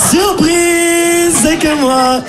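mock surprise. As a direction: shout surprise like a party host, then drop. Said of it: "Je suis". (surprise criée, faussement enjouée, plus aiguë)

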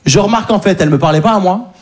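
ordinary talk

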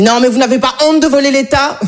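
high-pitched indignant scolding; very annoyed inspector. Acted. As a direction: tell off a fare dodger as an outraged ticket inspector. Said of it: "un contrôleur très énervé qui m'a dit". (contrôleur très énervé, voix haut perchée)

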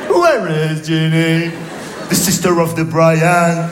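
put-on very slow, overdone English accent; schoolroom English accent. Comic recites school English question, slow exaggerated English accent. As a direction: drawl it in mock lesson English accent. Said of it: "Avec l'accent".

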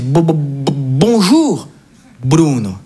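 stammering, choked-up imitation of an emotional greeting; moved grandfather. Grandfather greeting his grandson at the airport, moved and stammering. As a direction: stumble over the first word, then land it. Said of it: "il est tout ému".

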